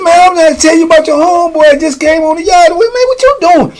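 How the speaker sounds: high-pitched imitated pleading protest; flustered protest. Man explaining himself to batter, high flustered protest. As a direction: protest urgently, climbing to a high squeal.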